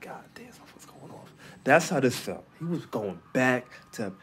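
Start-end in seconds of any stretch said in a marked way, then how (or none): 0.0-1.3 quick hushed whisper; whispered aside. Retold or imitated. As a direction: rush it out in an awed whisper. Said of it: "he's like".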